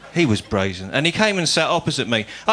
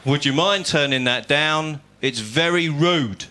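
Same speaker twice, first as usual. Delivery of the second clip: slow prim request; slower than usual. Retold or imitated. Polite request to teen.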